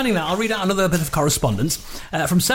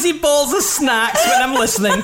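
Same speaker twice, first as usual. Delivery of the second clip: put-on drawn-out Scottish old lady, amused; slow comic Scottish accent, close to laughing; 5 semitones higher, slower than usual. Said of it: "done it in that accent".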